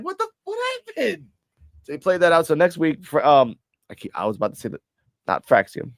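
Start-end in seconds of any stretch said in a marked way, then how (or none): 0.0-1.3 very high-pitched, softened disbelief; mock astonishment. Viewer reacting to the crying act, high-pitched mock astonishment. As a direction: squeak out baffled shock. Own startled reaction, retold.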